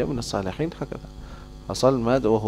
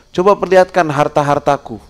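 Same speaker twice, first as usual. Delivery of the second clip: higher, louder demand to see his riches; rich man ordering; 5 semitones higher, 6 dB louder than usual. Retold or imitated. Rich man demanding to see his wealth, high and loud. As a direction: call for the treasures proudly.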